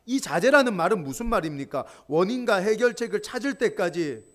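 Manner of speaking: ordinary talk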